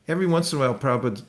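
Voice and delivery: ordinary talk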